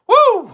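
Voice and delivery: loud, high "woo"; real burst of released tension; relieved whoop. Real, not acted. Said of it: "I was about to get heated".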